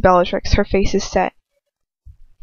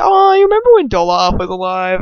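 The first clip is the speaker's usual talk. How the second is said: teasing laugh-voice; 5 dB louder than usual. Real, not acted. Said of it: "Okay, sorry".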